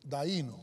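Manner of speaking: "Kerdaíno" with the accent on the í; "Kerdaíno" sounded out, í carrying the stress. Shown as a demonstration. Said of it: "Lleva acento en la I".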